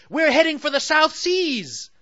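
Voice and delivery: put-on high, loud eager girl voice; rally your friend for adventure; excited Sally. Sally urging Ato toward the islands, high and loud.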